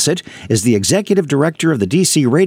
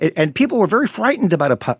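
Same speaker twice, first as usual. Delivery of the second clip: ordinary talk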